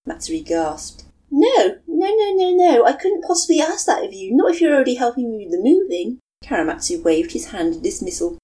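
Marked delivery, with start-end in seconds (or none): 1.3-6.2 flustered protest. Acted. Matsuri protesting.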